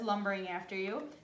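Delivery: ordinary delivery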